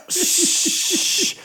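long imitated aerosol hiss; spray can hiss. Imitating beachgoers spraying on oil, long voiceless hiss. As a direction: make a drawn-out spraying noise.

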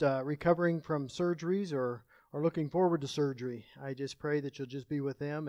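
soft, low, subdued prayer voice; congregational prayer. Real, not acted. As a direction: pray quietly and humbly, with small searching pauses.

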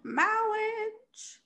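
high, drawn-out clergyman voice; the Princess Bride "mawwiage" voice; Princess Bride impression. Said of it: "I was gonna do that".

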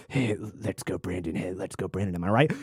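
low, soft smug snicker; snickering mock voice. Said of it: "snickering to".

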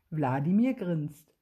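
ordinary delivery, nothing marked

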